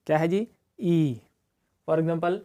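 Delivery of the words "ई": ई held long; long ee as in see. Shown as a demonstration. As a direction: draw the ई out. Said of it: "थोड़ा सा इसको लंबा खींचना है".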